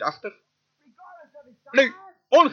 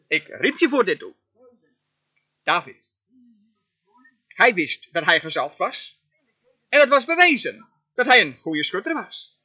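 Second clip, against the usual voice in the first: ordinary talk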